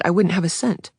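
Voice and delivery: usual speaking voice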